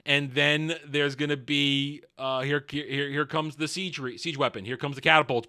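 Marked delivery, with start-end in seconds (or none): none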